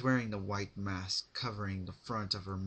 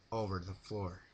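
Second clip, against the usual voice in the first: ordinary delivery, nothing marked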